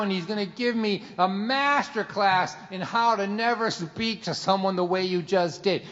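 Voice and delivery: normal talking voice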